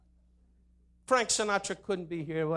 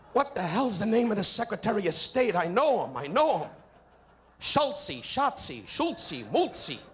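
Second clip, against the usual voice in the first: higher, louder mock fumbling for a name; flustered president; 4 semitones higher, 5 dB louder than usual. Comic mocking the president forgetting a name. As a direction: bluster while groping for the name.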